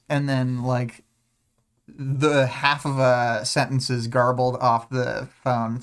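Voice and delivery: ordinary talk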